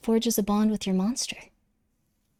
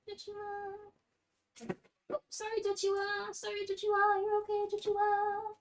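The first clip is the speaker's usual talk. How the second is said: much higher, sing-song voice; different voice; 10 semitones higher, 9 dB quieter than usual. Acted. Cooing to Joshua, apologizing, high sing-song voice. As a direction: croon his name up high, half singing. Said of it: "I talk so differently all the time".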